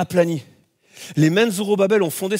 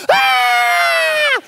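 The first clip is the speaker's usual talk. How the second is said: a sudden, much higher, louder shriek; high scream of fright; 6 dB louder than usual. Retold or imitated. Preacher reliving seeing his wife take off, shrieking. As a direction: let out a startled scream.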